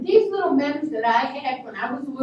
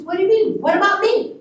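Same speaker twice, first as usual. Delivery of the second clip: high, loud protesting questions; retold daughterly indignation; indignant; 7 semitones higher, 5 dB louder than usual. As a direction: protest loudly, pitch jumping up. Retold protest to her daddy.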